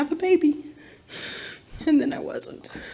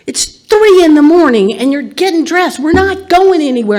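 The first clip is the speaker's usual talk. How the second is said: raised, exasperated voice at dad; exasperated shout; 5 semitones higher, 9 dB louder than usual. Retold or imitated. Daughter scolding her dad at three in the morning, exasperated shout. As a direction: scold him loudly, fed up.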